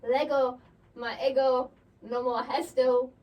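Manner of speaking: hammed-up fake Latin chant; mock Latin. Shown as a demonstration. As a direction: chant gibberish like a spell. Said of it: "I don't know this language".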